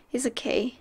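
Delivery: ordinary talk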